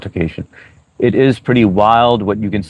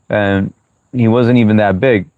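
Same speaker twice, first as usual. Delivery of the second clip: ordinary talk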